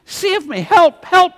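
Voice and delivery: loud, high panicked cry of a drowning man; desperate shout for help. Retold or imitated. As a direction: yell for help as if going under. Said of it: "He, he shouts".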